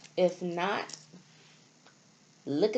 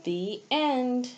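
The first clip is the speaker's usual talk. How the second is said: put-on reading to children. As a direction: read each line like a picture book page to a class. Reads storybook aloud to children.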